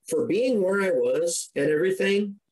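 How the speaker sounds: normal talking voice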